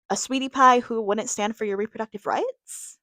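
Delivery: ordinary talk, nothing marked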